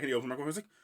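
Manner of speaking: usual speaking voice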